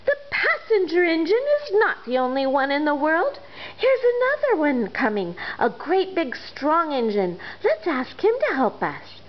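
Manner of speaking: a toy clown calling out hopefully; little clown calling. Acted. Toy clown calls to friends about another engine, eager. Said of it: "the little clown called out".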